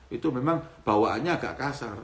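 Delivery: ordinary talk, nothing marked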